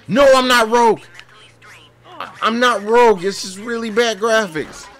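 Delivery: high, loud whiny protest; desperate protest. Real, not acted. Gamer pleading with police that he's not rogue. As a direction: plead your innocence, squeaky.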